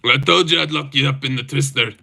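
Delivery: put-on Scottish accent, level in pitch. Streamer acting a fighter who locked someone up, Scottish accent. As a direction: tell them off in a mock Scottish accent. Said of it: "Scottish accent, by the way".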